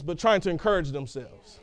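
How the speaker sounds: usual speaking voice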